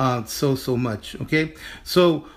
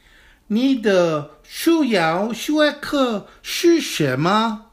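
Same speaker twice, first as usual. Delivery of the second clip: slow, higher, carefully articulated Chinese for students to repeat; slow model sentence; 6 semitones higher, much slower than usual. Shown as a demonstration. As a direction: model each syllable slowly, teacher style. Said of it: "let's get ready to say it".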